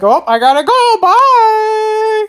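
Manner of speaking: a hint of a villain fleeing; hasty goodbye voice. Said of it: "It's like".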